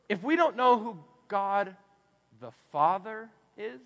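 slow, weighted "the Father is"; stress on the Father. Shown as a demonstration. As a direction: lean hard on "Father", unhurried. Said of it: "catch that last part".

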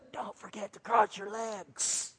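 normal talking voice

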